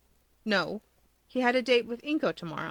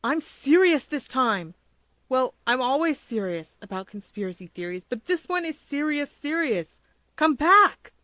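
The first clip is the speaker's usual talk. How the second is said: loud, urgent shouting after his friend; 5 semitones higher, 4 dB louder than usual. A narrator's reading. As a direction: yell to make him listen.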